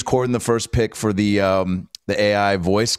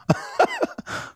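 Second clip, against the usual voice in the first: breathy laugh; 4 dB quieter than usual. Real, not acted. Laughing after teasing.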